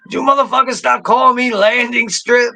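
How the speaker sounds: high-pitched, indignant Lando protest; hammed-up high complaint; mock indignation. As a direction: play up the outrage in a high voice. Voices Lando objecting to joke nickname.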